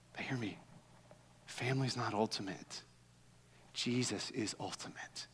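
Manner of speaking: softly driving home the core truth; hushed emphasis. Real, not acted. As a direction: drop to a near hush, pressing the point. Pastor drops to intimate emphasis.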